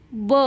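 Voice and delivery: ब as an isolated "buh"; phonic B sound. Shown as a demonstration. Teacher showing the sound of B, ब as "buh". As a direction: sound out ब, not the letter name. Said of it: "बीचा उच्चार होतो".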